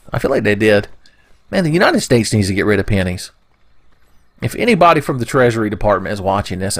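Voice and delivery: usual speaking voice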